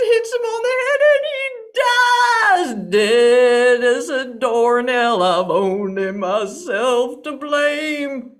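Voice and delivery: hammed-up crying lament; comic wailing. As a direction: blubber through it like a heartbroken fool. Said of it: "wailing and crying louder than ever".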